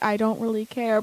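mildly dismissive, unimpressed; uninterested mom. Retold or imitated. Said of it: "she kinda had that tone of voice".

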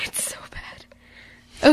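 breathless whisper, slow. Real, not acted. Host whispering an aside to her co-host about the gif. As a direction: whisper it like a guilty secret.

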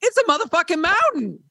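half-laughing disbelief at the size of it; a retold mock exclamation, not shouted; incredulous outburst. Retold realisation at the mountain.

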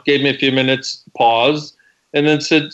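usual speaking voice

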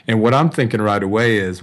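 ordinary talk, nothing marked

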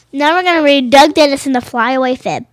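ordinary delivery

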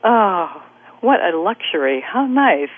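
ordinary talk, nothing marked

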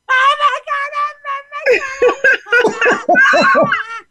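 high shrieking demo laugh; childlike laughing shriek. Shown as a demonstration. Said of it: "children laugh four hundred times a day".

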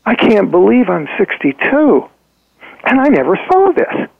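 high, rising disbelief at her own life; astonished realization. Retold or imitated. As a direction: play her gasping out the shock of seeing it at last. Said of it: "She goes".

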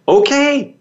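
a high, eager little okay; meek okay. Retold or imitated. Author accepting the publisher's offer, a high eager okay. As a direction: squeak out an instant yes. That was my canny negotiating.

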